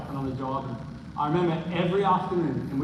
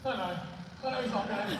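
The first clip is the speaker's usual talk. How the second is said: mocking himself in denial; 7 semitones higher, 5 dB quieter, faster than usual. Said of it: "the voice of reason I needed".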